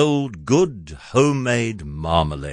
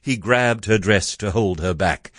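plain reading voice

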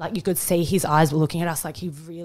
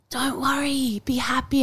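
dad's gentle reassurance; 7 semitones higher than usual. Retold or imitated. Dying dad comforts his emotional family, imitated high gentle reassurance. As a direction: lift the pitch and reassure lightly. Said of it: "he would just be like".

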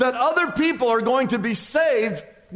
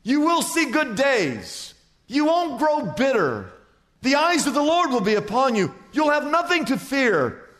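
preaching; 5 semitones higher than usual. Acted. Pastor pronouncing blessing on the congregation, preaching. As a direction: pronounce blessing over the church.

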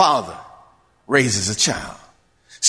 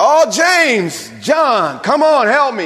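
higher, louder pleading call to friends; mock cry for help; 9 semitones higher, 3 dB louder, much slower than usual. Peter sinking and calling to James and John, mock cry. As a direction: ham up a panicked call to the disciples. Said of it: "he cried out".

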